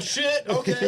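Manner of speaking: high, laughing mock backing-down; laughing imitation of meek guy. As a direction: play the cowed bystander while laughing. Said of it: "And he's like".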